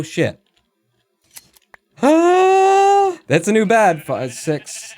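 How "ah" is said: sudden high loud yelp of fright; real startled scream; startled shriek. Real, not acted. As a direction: shriek as something jumps you.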